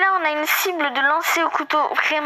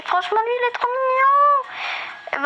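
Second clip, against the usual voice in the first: gushing over cuteness; 10 semitones higher, much faster than usual. Real, not acted. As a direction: melt over it, voice climbing high. Gushing over a cute pet. (attendrissement aigu, voix qui monte)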